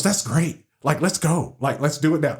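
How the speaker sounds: light imitation of a delighted wife; joyful wife. Husband retelling his wife's reaction, lightly joyful. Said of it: "She was happy and joyful".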